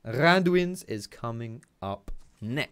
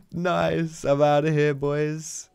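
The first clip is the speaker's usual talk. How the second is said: higher-pitched triumphant crowing; elated relief; 5 semitones higher, 3 dB louder, much slower than usual. Real, not acted. Player telling the boys he got away, elated. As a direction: celebrate the getaway, voice still raised. Celebrating his escape.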